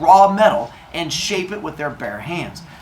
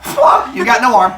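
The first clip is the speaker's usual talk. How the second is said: gleeful stab; 7 semitones higher, 7 dB louder than usual. Retold or imitated. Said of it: "they're like".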